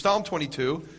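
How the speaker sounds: ordinary delivery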